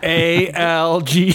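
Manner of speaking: slow separate letters; spelling it out. Shown as a demonstration. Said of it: "spell it for you guys".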